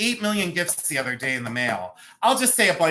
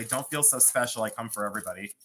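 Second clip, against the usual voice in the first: a touch of low, soft sass; deadpan put-down, softer; understated sassy comeback; mock sassy reply; 6 semitones lower, 5 dB quieter, faster than usual. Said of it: "And I'll say".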